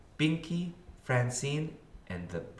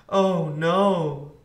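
loud, higher groan of dismay; dismayed sigh; 7 semitones higher, 8 dB louder than usual. A narrator's reading. Arthur finding D W there first, dismayed sigh. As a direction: let out a big storybook "oh no". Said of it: "sighed Arthur".

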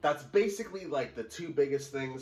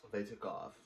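ordinary talk, nothing marked

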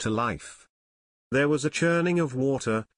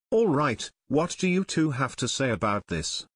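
ordinary delivery, nothing marked

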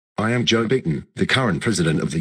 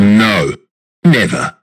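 loud, emphatic refusal; firm angry refusal; 3 semitones higher, 9 dB louder, slower than usual. Acted. Biden refuses to let her inside, loud and angry. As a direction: refuse at full volume.

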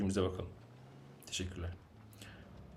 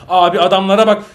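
much louder, higher outburst; outraged shout; 4 semitones higher, 12 dB louder than usual. Real, not acted. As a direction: blow up at what he sees. Shouting at teammates' play.

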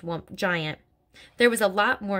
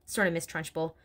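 ordinary delivery

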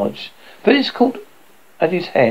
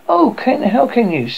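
higher, lightly acted outcry; indignant cry; 7 semitones higher than usual. A narrator's reading. Phyllis reproaching her brother, higher mild cry. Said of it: "cried Phyllis".

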